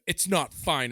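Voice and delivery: mock indignant correction; retold angry retort. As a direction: snap back as the custodian. Retold custodian snapping back.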